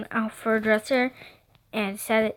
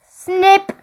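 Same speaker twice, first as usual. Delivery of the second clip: sudden high, loud "snip"; scissor snip; 8 semitones higher, 7 dB louder than usual. A narrator's reading. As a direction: snap out the scissor sound. Imitated sound of scissors.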